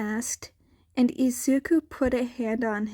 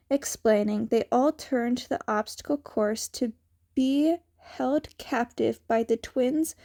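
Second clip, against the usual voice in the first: plain reading voice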